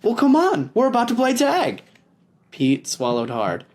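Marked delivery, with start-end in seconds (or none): 0.0-1.8 put-on high kid voice, fast and excited; eager David. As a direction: call a friend to play.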